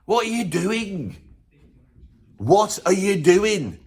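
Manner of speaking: slow, drawn-out disbelief; slow mock despair at an aging rocker; exasperated plea. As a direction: plead with him to stop, dragging each word. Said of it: "I feel like going".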